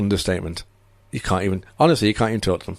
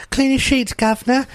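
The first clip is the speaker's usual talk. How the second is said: high, hammed-up Cockney maid voice; put-on Cockney accent, pitched well up; mock Cockney accent. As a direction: play a cheeky Cockney chambermaid in a put-on accent. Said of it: "I don't know why I had to use an accent".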